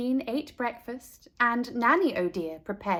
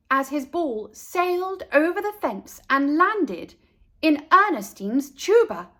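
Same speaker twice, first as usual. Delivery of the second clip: ordinary delivery, nothing marked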